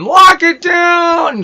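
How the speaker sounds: high, drawn-out panicked yell; freaking-out shout. Retold or imitated. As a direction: mimic panicking advisors at full shout. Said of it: "all freaking out saying".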